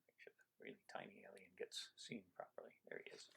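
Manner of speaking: hushed, secretive whisper. Acted. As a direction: whisper so the tiny alien can hear. Pointing out the tiny alien.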